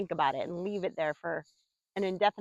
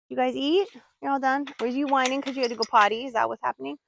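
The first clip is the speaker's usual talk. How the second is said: higher, louder sing-song questions to the dogs; pet talk; 5 semitones higher, 4 dB louder than usual. Real, not acted. Owner asks her dogs if they need to go potty, higher sing-song voice. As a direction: coo the questions at the pups.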